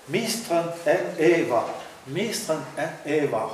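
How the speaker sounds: slow, stressed "mistrant en Eva" with weight on each accent; demonstrating stressed reading. Shown as a demonstration. Scholar demonstrating the tonic accents to colleague, slow and deliberate. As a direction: read "mistrant en Eva" slowly, leaning on the tonic syllables. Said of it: "vous demander d'en dire un peu plus avec l'accent".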